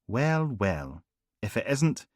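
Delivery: a different voice, rising on isnt; Harper's mock greeting. Harper teasing Logan at the churchyard, arch. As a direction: arch superior officer echoing the line.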